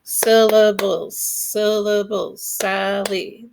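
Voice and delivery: "Syllables" said slowly, each syllable separate; syllables sounded out. Shown as a demonstration. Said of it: "has to have five syllables".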